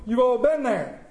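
usual speaking voice

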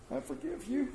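hushed, gentle I forgive you with a lift; soft forgiveness. Acted. Preacher voicing a farmer forgiving the tractor scratch, hushed.

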